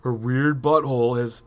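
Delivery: ordinary talk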